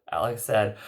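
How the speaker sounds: ordinary delivery, nothing marked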